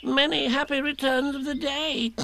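very high little voice; Piglet, well-wishing. A narrator's reading. Piglet repeating birthday wishes to Eeyore, high-pitched. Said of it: "said Piglet again".

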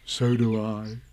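low, contented reply; tender. A narrator's reading. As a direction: answer a friend fondly, low. Pooh answers fondly.

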